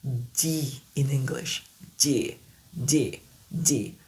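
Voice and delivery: English D repeated; D anglais lent. Shown as a demonstration. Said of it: "asseoir la prononciation du son de la lettre D".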